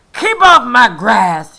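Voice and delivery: loud, slow furious shout; angry shout. Acted. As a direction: shout him off the lawn. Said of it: "shouted Hank".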